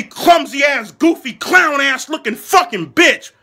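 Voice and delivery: usual speaking voice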